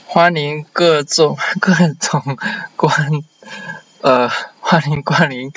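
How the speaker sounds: halting, slow Mandarin with heavy accent; stumbling through Mandarin greetings; foreigner speaking mandarin. Real, not acted. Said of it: "Sorry, my Chinese is really bad".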